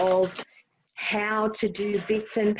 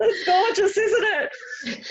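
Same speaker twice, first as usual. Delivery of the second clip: high, gushing, through laughter; laughing mock gush; 10 semitones higher, slower than usual. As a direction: gush over it while laughing at yourself. Mocking own pride, laughing.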